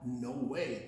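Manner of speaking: ordinary delivery, nothing marked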